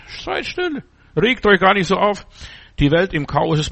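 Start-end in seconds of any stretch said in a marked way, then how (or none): 0.1-0.8 hushed high "Seid stille", much softer. Shown as a demonstration. Preacher hushing the congregation, much softer and higher. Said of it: "seid stille und erkennt".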